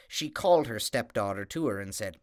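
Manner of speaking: ordinary delivery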